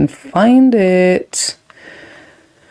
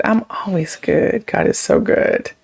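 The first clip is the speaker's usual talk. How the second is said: whisper; 4 dB quieter than usual. Real, not acted. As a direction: say it softly like a secret. Whispered aside to chat.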